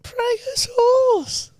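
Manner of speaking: very high, very slow mocking echo; mock posh echo. Teasing his co-host about Prego sauce on the couch, drawn-out high mockery. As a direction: stretch it out to tease her. Mocking co-host's fancy order.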